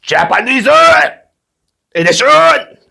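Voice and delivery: high sing-song put-on Japanese accent; playful Japanese accent announcement; mock Japanese accent. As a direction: lightly mimic a Japanese announcer. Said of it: "or should I say".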